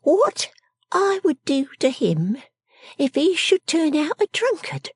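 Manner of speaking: high, slow, quaint little voice; pensive Miss Jenny. Acted. Miss Jenny pondering a drunkard suitor, high slow musing. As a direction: muse slowly in a high character voice.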